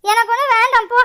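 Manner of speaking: loud sulky refusal; angry shout. Acted. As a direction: shout it at mother, fed up. Daughter refuses food angrily.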